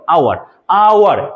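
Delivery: আওয়ার said as "aoar" with silent h; pronounced hour. Shown as a demonstration. English teacher showing আওয়ার with the h dropped. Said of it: "আয়ের মতো উচ্চারণ হচ্ছে".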